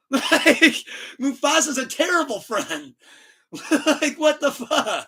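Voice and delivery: high-pitched, laughing disbelief; incredulous comic rant breaking into laughs; laughing outrage. Real, not acted. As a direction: protest through real laughter, pitch way up. Mock outrage at Mufasa, laughing.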